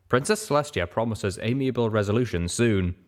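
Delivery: usual speaking voice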